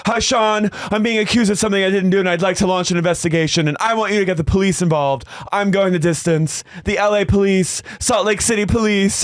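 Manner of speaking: high-pitched indignant imitation; hammed-up outrage on a phone call; mock worked-up. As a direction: imitate someone urgently calling in security, pitched right up. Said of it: "she's shaking and so red".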